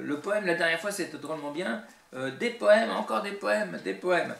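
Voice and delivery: lifted, insistent mimicry; eager viewers, imitated. Voices viewers' messages requesting poems.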